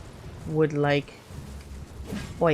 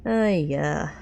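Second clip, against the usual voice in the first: ordinary talk, nothing marked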